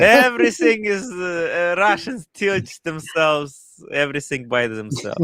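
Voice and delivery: lightly put-on Russian accent; a hint of a Russian accent. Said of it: "my Russian accent is pretty legitimate".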